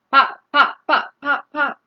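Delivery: quick little popping sounds; popcorn pops. Shown as a demonstration. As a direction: pop like popcorn. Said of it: "Sometimes it sounds like".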